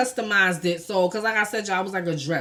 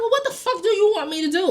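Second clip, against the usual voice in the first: exasperated retort; 10 semitones higher, faster than usual. Retold or imitated. Retold retort to boyfriend's complaint.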